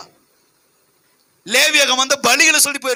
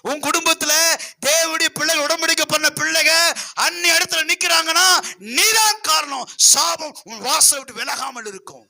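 rising, higher warning that ends in a shout; fiery preaching; 6 semitones higher than usual. Real, not acted. Pastor warning the congregation about curses, rising to a shout. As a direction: preach it hard, climbing to a shout.

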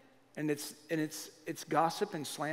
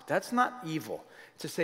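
ordinary talk

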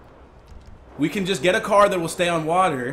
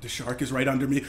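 ordinary talk, nothing marked

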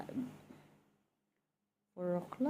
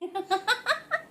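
delighted laugh. Real, not acted. Mother laughing with her baby, delighted. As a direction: burst into a giggle.

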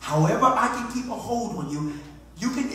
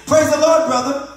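high, loud hail; exaggerated hearty hello; put-on church greeting; 9 semitones higher, 11 dB louder than usual. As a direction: greet a church brother with raised pitch and volume. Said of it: "In that deep voice".

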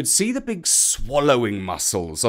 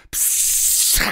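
snake hiss. A narrator's reading. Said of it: "snake hiss".

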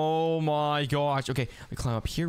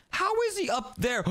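high, shouted disbelief; much higher shocked cry; astonished shout; 14 semitones higher than usual. Real, not acted. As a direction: yell out in real shock. Spots brother on the roof.

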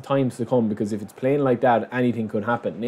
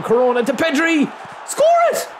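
ecstatic shout. Real, not acted. Gamer scoring the winner to his viewers, ecstatic shout. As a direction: scream the goal, then burst out laughing.

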